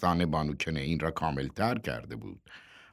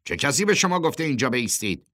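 raised voice, higher and quick; loud demand; 7 semitones higher, 4 dB louder, faster than usual. A narrator's reading. Worker on the truck challenges those standing there, loudly. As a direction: call it out as a challenge. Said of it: "کسی با صدای بلند گفت".

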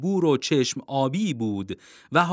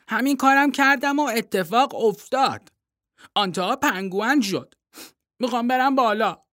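put-on a boy's voice, higher, insistent; young boy Timothy; 8 semitones higher than usual. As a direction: read the boy higher and earnest.

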